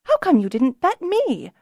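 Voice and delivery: mock indignant. A narrator's reading. Kelsa protests she was left out of the bet, high and put out. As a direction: pout about being left out.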